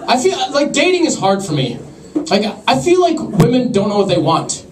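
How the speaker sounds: normal talking voice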